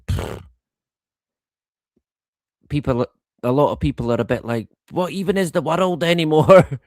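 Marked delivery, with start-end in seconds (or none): 4.9-6.8 higher-pitched, mock-baffled outburst; exasperated bewilderment. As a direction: imitate people at their wits' end. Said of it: "pulling their hair out".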